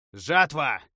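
loud announcement. A narrator's reading. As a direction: spell name called out loud. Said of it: "объявил я".

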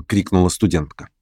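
plain reading voice

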